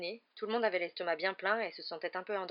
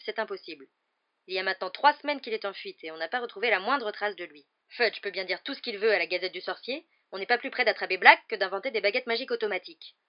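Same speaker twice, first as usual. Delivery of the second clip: animated, often louder argument; arguing couple; 3 semitones higher, 8 dB louder than usual. A narrator's reading. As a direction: argue back insistently.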